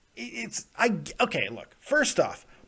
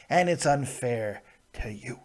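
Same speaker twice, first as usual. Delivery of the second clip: usual speaking voice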